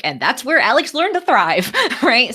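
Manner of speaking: high, loud, laughing quote. Retold or imitated. Laughing as she quotes Rebecca's line. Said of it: "she's like".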